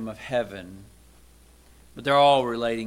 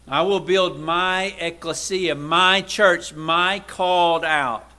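louder, higher preaching with my punched hard; forceful proclamation; 4 semitones higher, 6 dB louder, slower than usual. Acted. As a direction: proclaim it to the congregation. Proclaims Jesus' words.

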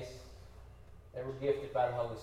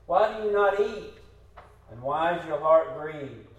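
loud, emphatic Bible reading; scripture reading; 2 semitones higher, 7 dB louder than usual. Acted. Preacher reads Hannah's story to the congregation, loud and measured. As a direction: read the passage out firmly to the room.

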